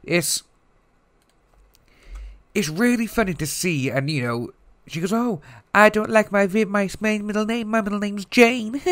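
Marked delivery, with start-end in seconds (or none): 5.1-8.8 high mock-girly voice; high put-on voice mocking the deponent's answers; mocking squeaky voice. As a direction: pitch it way up, like a silly girl. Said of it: "no one speaks like that".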